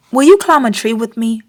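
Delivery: plain reading voice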